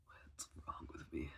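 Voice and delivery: slow whisper to himself; slow, hushed whisper. Real, not acted. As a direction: whisper it slowly, almost to yourself. Troubled aside to himself.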